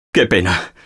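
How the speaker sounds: usual speaking voice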